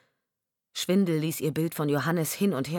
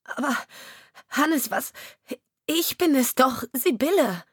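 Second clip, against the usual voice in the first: desperate plea; 8 semitones higher, 3 dB louder than usual. Acted. Wife pleads to be recognized.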